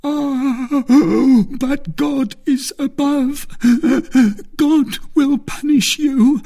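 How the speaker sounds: very high, loud, sobbing wail; agonised curse. A narrator's reading. Dying soldier praying for his poisoner's punishment, agonised wail. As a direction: call down punishment through gasps of agony.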